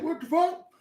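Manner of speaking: high, startled retold outburst; shocked disbelief. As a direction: react like you can't believe it. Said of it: "I was like".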